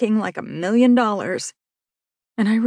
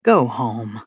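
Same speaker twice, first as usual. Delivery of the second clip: put-on calm a worked-up girl; the cop soothes the narrator; soothing; 9 semitones lower than usual. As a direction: lower man's voice, soothing. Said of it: "he said soothingly".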